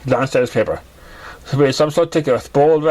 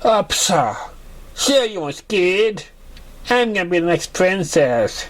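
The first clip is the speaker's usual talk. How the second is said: higher, sarcastic drawn-out teasing; mocking friend pony; 6 semitones higher than usual. A narrator's reading. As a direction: scoff at him playfully.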